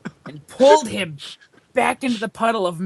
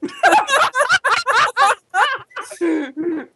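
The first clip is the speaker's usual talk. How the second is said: high squealing laugh; high laughing fit; 4 dB louder than usual. Real, not acted. Narrator laughs at interruption.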